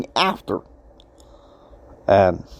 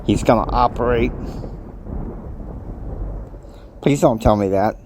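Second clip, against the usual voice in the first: much lower, sinking groan of dread; dismayed dread; 4 semitones lower than usual. Retold or imitated. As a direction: dread the bad news. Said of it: "And I was like".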